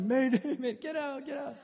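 high laughing mayday cry; joking panic over the shower; mock panic. Dad panics at shower backup.

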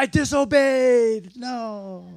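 loud, high, drawn-out mock wail; hammed-up howl of regret over a mistake; anguished cry. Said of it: "you're like".

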